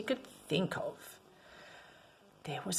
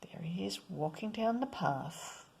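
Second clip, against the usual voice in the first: storyteller whisper; 12 dB quieter than usual. Acted. Hushed storytelling aside.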